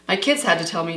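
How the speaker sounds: normal talking voice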